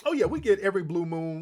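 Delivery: ordinary talk, nothing marked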